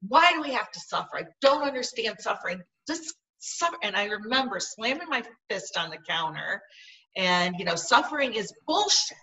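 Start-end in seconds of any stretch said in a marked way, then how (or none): 0.1-3.8 higher, louder angry cry at the heavens; retold yelling at God. Grieving woman shouts at God about suffering, loud and angry. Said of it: "I yelled that". 7.8-9.2 higher, louder furious outburst; retold yell. Grieving woman yells at God over suffering, loud. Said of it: "I yelled that".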